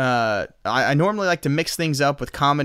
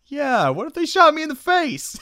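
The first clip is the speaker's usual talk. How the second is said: much higher and louder comic voice; mock-outraged echo; 13 semitones higher, 6 dB louder than usual. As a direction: throw the question back, hammed up. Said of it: "It's like".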